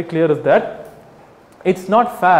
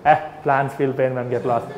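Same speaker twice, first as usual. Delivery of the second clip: lower, softer sneering brush-off; mock dismissive retort; 5 semitones lower, 4 dB quieter than usual. Imitating a meat eater dismissing the vegan plea, low sneer. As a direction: toss it off like a smug heckler.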